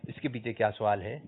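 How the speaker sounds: ordinary talk, nothing marked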